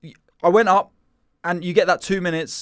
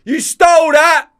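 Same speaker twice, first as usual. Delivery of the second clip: high, loud, slow accusing yell; heckler shout; 12 semitones higher, 10 dB louder, slower than usual. Retold or imitated. Heckler at the back accusing the comic. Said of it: "shouts out".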